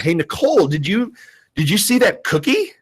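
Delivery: teacher nudging Nicole with a hidden code word, rising hint; coded hint. Retold or imitated. Teacher hinting to friend covertly.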